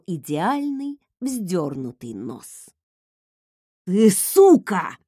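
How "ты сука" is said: loud, drawn-out roar of an insult; angry roar. A narrator's reading. Marcel roars at the woman who punched his nose. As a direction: roar it slowly and forcefully. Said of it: "взревел он".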